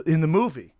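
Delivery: ordinary delivery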